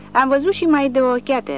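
cocky proud sneer; proud grin. A narrator's reading. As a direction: brag with a smirk. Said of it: "rânjește Wolf cu mândrie".

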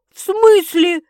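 high, loud startled question; astonished. A narrator's reading. Sister hearing the boss's demand, astonished. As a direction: burst out in disbelief. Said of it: "изумилась Светка".

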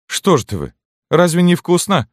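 high, loud, surprised question; canteen woman. A narrator's reading. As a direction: voice a woman's high-pitched, incredulous asking. Canteen woman coaxes the children. (высокий громкий голос маркитантки)

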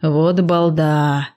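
low, slow affectionate tease; tenderly amused scolding; fond. A narrator's reading. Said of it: "умилился упырь".

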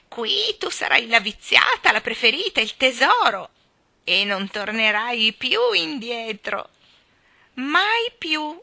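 loud, swooping, menacing promise; gloating old great-grandfather. Acted. Great-grandfather claims Jane as his treasure, gloating. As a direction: relish trapping the child, drawing out the ends.

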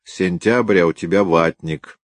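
usual speaking voice

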